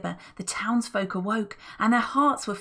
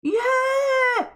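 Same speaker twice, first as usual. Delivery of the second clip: high wild shriek; goblins scream; 7 dB louder than usual. Acted. Goblins cheering the plan, shrieking. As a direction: scream with glee. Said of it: "screamed the goblins".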